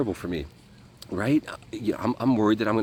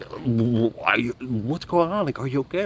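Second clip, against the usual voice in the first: alarmed; 5 semitones higher than usual. Retold or imitated. Panicked at the seizure.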